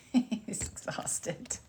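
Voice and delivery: very slow, breathy whisper through a laugh. Real, not acted. Laughing remark about her tired dog.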